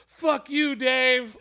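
high-pitched mock crowd yell; retold yell. Crowd yells at promoter in back, mock yell. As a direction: throw it to the back of the room, half-force. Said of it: "they would, like, yell it".

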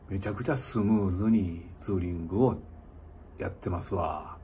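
ordinary talk

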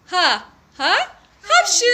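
playful fake sneeze, building up high; ha ha then a big mock achoo; sneezing. Sneeze at end of kids' rhyme.

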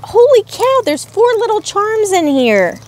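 high, squealing amazement; excited squeal. Real, not acted. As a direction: squeal at the pile of charms. Finding more charms.

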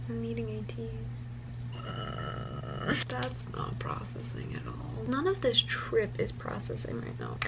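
whispering. Real, not acted. Whispering while eating.

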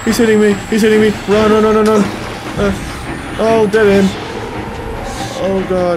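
panicked, high and loud; being chased. Real, not acted. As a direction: shout in panic while fleeing.

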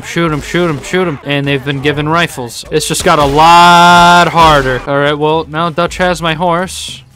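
ordinary delivery

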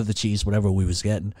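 ordinary talk

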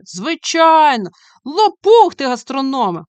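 loud, high drawn-out cry of agreement; excited shout. A narrator's reading. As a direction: shout it out together with the gang.

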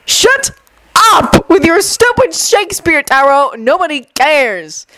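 high cry falling to a slow dismissal; explode, then drag out the put-down; exasperated outburst. Acted. Jun cuts off Taro's quoting.

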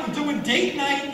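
ordinary talk

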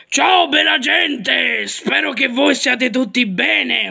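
slow, shouted big greeting; hammed-up puppet host greeting; Gabibbo voice. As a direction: boom out the hello slowly. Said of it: "faccio la voce di Gabibbo".

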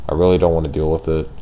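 usual speaking voice

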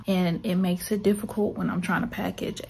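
ordinary delivery

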